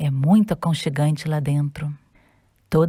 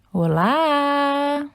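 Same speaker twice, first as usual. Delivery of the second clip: cheerful new voice; 8 semitones higher than usual. Acted. New voice from under drawer.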